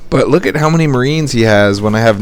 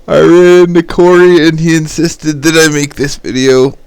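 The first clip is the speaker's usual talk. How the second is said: excited; 7 semitones higher, 6 dB louder than usual. Real, not acted. Excited video opening.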